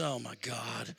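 very quiet, low groaned words; hushed, retold dismay; exasperated aside. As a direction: sigh it out under your breath. Exasperated reply to wife.

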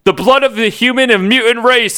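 theatrical villain shout; mock shout. A narrator's reading. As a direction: declare it like a cartoon overlord. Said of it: "Apocalypse shouted".

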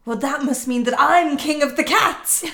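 loud gleeful proclamation; triumphant cat. Retold or imitated. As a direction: crow it with delight. Cat declares itself king.

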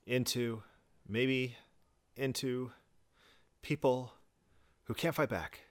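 a hint of a mocking halting echo. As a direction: echo him word by word, quietly mocking.

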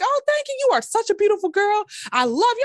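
high, sugary imitation of an older woman gushing; gushing church lady. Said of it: "and she's like".

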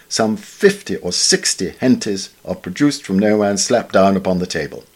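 usual speaking voice

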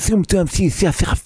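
high, lisping mock-English babble; English as foreigners hear it. Repeating what Russians hear, mock-English gibberish. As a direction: babble English with every sound a TH. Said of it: "the T H".

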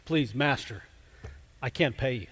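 softly pleading, a touch of desperation; a mild, understated plea from a frightened servant. Retold or imitated. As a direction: beg quietly for mercy. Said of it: "the servant pleads with him".

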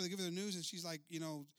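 ordinary talk

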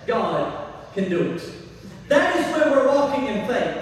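normal talking voice

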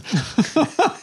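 high burst of laughter. Real, not acted. Painter laughing after telling the story, high laugh. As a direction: laugh at the punchline.

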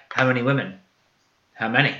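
low, soft interrogation; quietly intimidating. Retold or imitated. Arya pressing Gendry for answers, quietly intimidating. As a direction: imitate a cool, hushed questioning. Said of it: "watching her intimidate Gendry like that".

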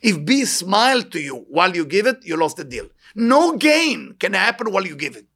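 ordinary talk, nothing marked

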